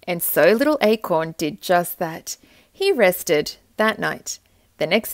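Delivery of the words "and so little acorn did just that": ordinary delivery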